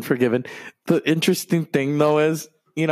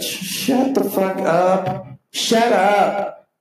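fed-up yell; 5 semitones higher, much slower than usual. Retold or imitated. Fan yelling at Lars to shut up. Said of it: "it was like".

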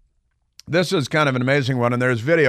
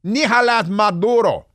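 Spanish accent, pitched higher and louder, hammed-up; mock Spanish accent; 8 semitones higher, 4 dB louder than usual. Shown as a demonstration. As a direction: show off a mock Spanish "Nicolás Maduro". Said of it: "Excuse me".

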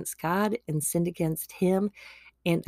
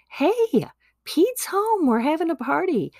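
very high, loud joyful call; excited dad; 11 semitones higher, 4 dB louder than usual. Acted. Dad telling older son, very high joyful call. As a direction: call out the good news. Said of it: "he's like".

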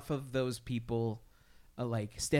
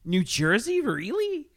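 incredulous; 8 semitones higher, 6 dB louder, slower than usual. Retold or imitated. Reacting to surprising news story.

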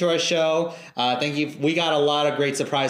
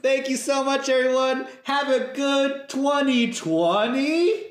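playful high outro; 8 semitones higher, slower than usual. Pretend.

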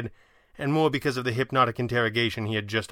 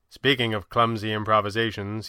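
ordinary delivery

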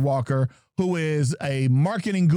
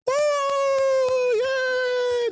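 drawn-out, high-pitched mock crowd cheer; cheering sound effect. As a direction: do your own celebration sound effect. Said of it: "The sound effects, sound effects, sound effects".

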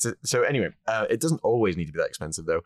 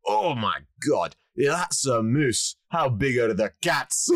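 slow, higher-pitched Scottish punchline; Glaswegian accent; 5 semitones higher, slower than usual. Acted. Glaswegian reacting to the moose head, broad accent. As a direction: draw it out as an amazed Glaswegian. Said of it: "the Glaswegian goes".

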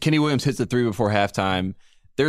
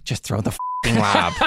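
imitating coach; 2 semitones lower, slower than usual. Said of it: "what did he say, you think".

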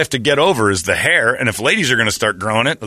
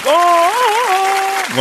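a squeaky high yelp; high falsetto gasp. Retold or imitated. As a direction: shriek out a high Oh. Heard exclamation, very high.

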